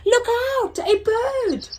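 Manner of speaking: high, loud panicked warning; panicked cry. Acted. Snails warn each other, high panicked cry. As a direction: shriek it as the bird swoops. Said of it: "cried the snails in panic".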